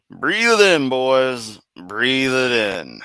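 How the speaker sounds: big high loud call, then slowing way down; savoring hype. Acted. Streamer hyping up the boys before the read, loud then slow. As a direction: boom it out, then drag the last part slow. Relishing the moment before reading.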